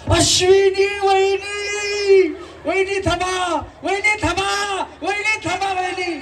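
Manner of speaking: high, long-drawn holler; hammed-up high, slow call; mock desperate calling. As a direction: call after her, stretching every name. Calls after departing contestant.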